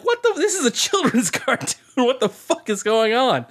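high-pitched, incredulous viewer, laughing midway; mock bewildered outburst. Viewer stunned by a children's cartoon, mock bewildered outburst. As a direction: squeal it in exaggerated disbelief. Acted baffled viewer reaction to the show.

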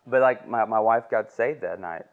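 normal talking voice

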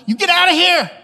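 sudden high, loud yell; mock angry outburst. Jesus turning on Peter minutes later, mock yelling. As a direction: erupt in a comic banishing shout. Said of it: "it's like ten minutes later".

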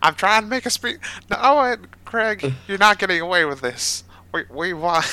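high, loud defiant shout; heroic confrontation. Acted. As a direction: call out the villain. Character confronts Craig.

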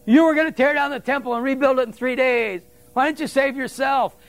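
a hint of a scornful passerby taunting; mocking taunt. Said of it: "they're hurling insults at him".

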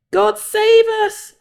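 high, stretched-out plea; crying out. A narrator's reading. Second sailor begs God in the storm, crying out. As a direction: call it out high and slow, pleading. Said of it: "another cried".

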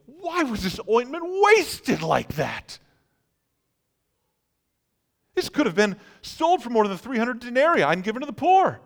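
high, loud scolding protest; indignant. Acted. Disciples protesting the wasted ointment, indignant. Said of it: "said to themselves indignantly".

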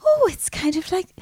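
high, swooping Donegal lilt; Yvonne's Donegal accent. Retold or imitated. Guest trying out a woman's Donegal voice, high lilting accent.

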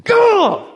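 loud, very high frustrated yell; explosive, exaggerated "ugh!" of frustration; exasperated cry. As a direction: burst out in acted exasperation. Acted frustration of imprisoned Joseph.

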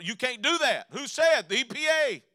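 loud, high back-and-forth of an argument; mock quarrel. Preacher acting out a run-in with the EPA, loud. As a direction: snap it out like a bossy official.

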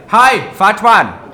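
slow, loud, sneering hello; slow taunting greeting to his rival; cocky greeting. Retold or imitated. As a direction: drawl it out to provoke him. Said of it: "You said that?".

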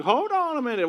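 high, loud protest; alarmed interruption. Retold or imitated. Son cutting off dad blaming mom, alarmed interruption. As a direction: cry out to halt someone mid-accusation.

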